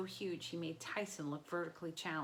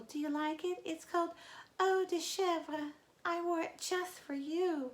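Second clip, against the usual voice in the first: much higher flirty bride voice, slowing down; coy and nervous, playing along; Grover in falsetto; 11 semitones higher, 4 dB louder than usual. A narrator's reading.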